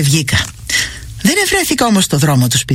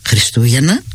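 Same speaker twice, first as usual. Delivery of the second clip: puzzled question; 6 semitones lower than usual. A narrator's reading. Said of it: "Χριστούγεννα είναι".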